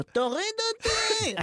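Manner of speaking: high, drawn-out mock scream; retold panicked scream. Passenger filmed in the plane begging out, mock high scream. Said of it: "רק צועק".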